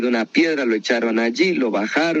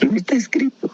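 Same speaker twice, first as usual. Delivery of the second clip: hushed secretive whisper; 11 semitones higher, faster than usual. Acted. Preacher whispering to listeners about unwritten things, hushed. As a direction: breathe it like a secret.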